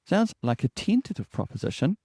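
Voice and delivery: tentative uplift on proposition; rising upward inflection. Shown as a demonstration. Coach demonstrating the tentative habit, rising tune at the end. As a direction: lift the end of the sentence like a question. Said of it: "I'm doing it now".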